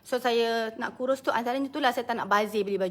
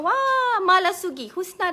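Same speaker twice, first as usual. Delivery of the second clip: much higher, loud "Wah"; a thrilled gasp at the name; delighted surprise; 8 semitones higher, 6 dB louder, slower than usual. Real, not acted. Host delighted by an Indonesian viewer's name.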